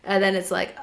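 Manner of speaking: normal talking voice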